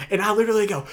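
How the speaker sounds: ordinary delivery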